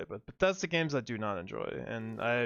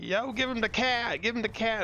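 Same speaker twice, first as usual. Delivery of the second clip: a much higher, far-off voice mocking the guys' inside joke; imitating Southern friends; 10 semitones higher than usual.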